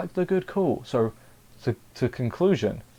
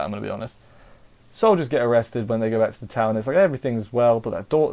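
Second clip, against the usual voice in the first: usual speaking voice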